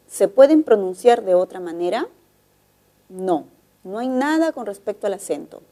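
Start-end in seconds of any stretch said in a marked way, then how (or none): none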